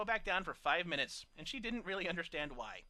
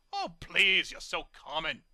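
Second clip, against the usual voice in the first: loud, sneering put-down; snorting scorn; 7 dB louder, slower than usual. A narrator's reading. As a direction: snort it dismissively. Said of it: "he snorted".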